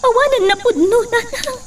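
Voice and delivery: crying. Acted. Daughter tearfully confesses to mother.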